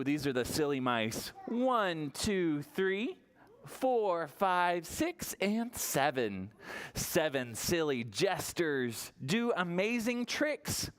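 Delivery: ordinary delivery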